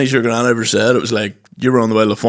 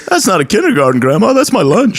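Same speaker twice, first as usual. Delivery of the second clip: high mock protest; indignant dog; 7 semitones higher than usual. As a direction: whine it like an offended dog. The dog answers granny.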